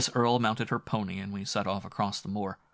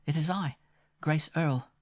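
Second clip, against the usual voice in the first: put-on higher, lightly teasing girl's voice; playful young woman; 8 semitones higher, 3 dB louder than usual. A narrator's reading. Grace teasing the frightened Winmore on the stairs, playful high voice. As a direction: tease him gently, she's quite safe.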